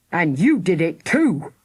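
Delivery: father snarling blame at his son. Acted. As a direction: bite the words out at his son. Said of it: "snarled Mister Thunder".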